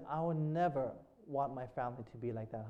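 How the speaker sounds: low, hushed reflection; inner thought. Retold or imitated. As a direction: say it softly, as if to yourself. Preacher voices his own private thought.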